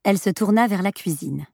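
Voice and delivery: usual speaking voice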